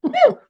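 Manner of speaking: loud high whoop; high cheer. Real, not acted. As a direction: cheer like the show just started. Cheering as stream goes live.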